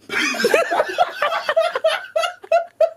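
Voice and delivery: high, helpless giggling at his own blooper; real laughing fit. Real, not acted. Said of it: "Just do it again".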